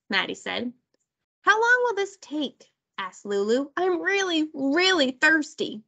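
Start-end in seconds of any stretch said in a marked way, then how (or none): 1.4-2.7 put-on high-pitched child's whine; impatient whine. A narrator's reading. Thirsty Lulu asks the big kids, whining impatiently. 3.8-5.8 put-on raised, dragged-out child's whine; whiny complaint. A narrator's reading. Lulu tells the big kids she's thirsty, whining.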